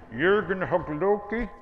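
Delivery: a hammed-up Norwegian accent on the name; mock Norwegian accent. Shown as a demonstration. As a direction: say "Jürgen Hökloki" in an exaggerated Norwegian accent, slowly and loud. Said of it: "you gotta hold your face when you say it".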